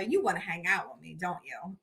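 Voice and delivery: low, soft, confidential taunt; knowing tease. Retold or imitated. Mom planning what to tell the girl, teasing. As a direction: drop low and quiet to tease.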